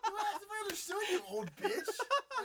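laughing retort. Retold or imitated. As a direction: laugh out the insult, dragging it slowly. Retelling his cheeky retort, laughing.

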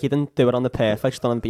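usual speaking voice

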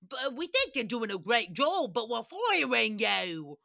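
hammed-up, badly faked Irish accent. Shown as a demonstration. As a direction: attempt an Irish accent and overdo it. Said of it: "can I do an Irish accent".

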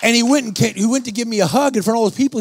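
ordinary talk

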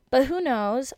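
who knows thrown out loud and high; playful loud dismissal; loud shrug. Real, not acted. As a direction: toss it off with a big shrug. Shrugging off vegetable shortage.

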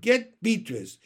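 high, loud urgent call. A narrator's reading. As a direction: send for help from the crowd. Child calling out from crowd.

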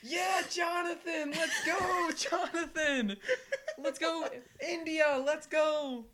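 high, drawn-out shouted cheer; cheering fan. Retold or imitated. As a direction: holler wildly for your favourite player at bat. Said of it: "was waving it like".

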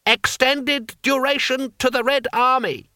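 high-pitched cry from the crowd; shouted slogan. A narrator's reading. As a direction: call out a stilted rallying slogan. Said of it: "Then a voice shouted".

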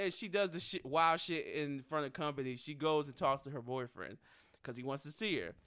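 normal talking voice

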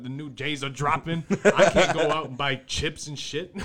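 loud, laughing mock rant; laughing yell. Acted parent shouting, laughing.

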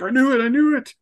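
high gleeful gloating squeal; mock squeal. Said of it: "And Wayne's like".

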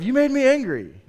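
high, loud mock blame; mock accusation. Preacher quoting an angry blamer, high mock accusation.

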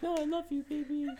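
very high mock-sweet coo; mock lovey-dovey. Girlfriend making up with boyfriend, mock lovey-dovey squeal. Girlfriend cooing in acted quarrel.

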